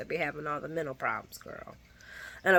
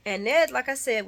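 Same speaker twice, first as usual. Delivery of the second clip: ordinary delivery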